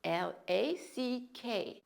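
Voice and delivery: letters spelled out slowly one by one. Shown as a demonstration. Teacher spelling a word for students, slow.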